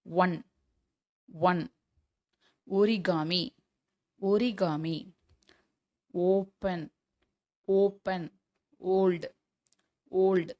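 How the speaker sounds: "ஒன்", "ஒரிகாமி", "ஓப்பன்", "ஓல்டு" with "o" at the start; ஓ sound demo. Shown as a demonstration. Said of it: "next ஓ". ("ஒரிகாமி", "ஓப்பன்", "ஓல்டு" ஓ ஒலியில் தனித்தனியாக)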